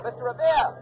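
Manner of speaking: calling out high across the green. Acted. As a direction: hail him as he hurries past. Reporter calling to passing Revere.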